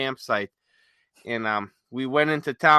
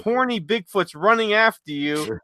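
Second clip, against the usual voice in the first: retold teasing; 5 semitones higher, 5 dB louder than usual. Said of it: "I said".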